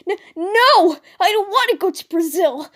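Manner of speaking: high, loud stammered protest; crying out. A narrator's reading. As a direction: protest in panic before being shoved. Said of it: "Mako cried out".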